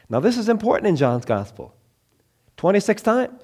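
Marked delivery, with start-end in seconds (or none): none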